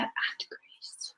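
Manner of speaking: soft whisper, barely voiced; whispered aside. Real, not acted. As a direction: slip it in as a hushed aside. Adds a quiet aside.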